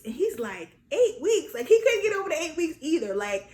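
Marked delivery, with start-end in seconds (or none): 0.9-1.5 loud, high incredulous question; high-pitched burst of disbelief; husband's retold disbelief. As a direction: echo it back, astounded. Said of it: "stuck on stupid with this whole eight weeks thing".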